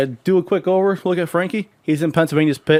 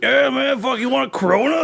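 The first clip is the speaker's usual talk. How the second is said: put-on high-pitched drunk buddy voice; Frankie Rappa; 7 semitones higher than usual. Drunk racer offers friend a morning beer, tipsy. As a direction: offer a drink in a raised, tipsy voice. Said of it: "You fucking drunk already?".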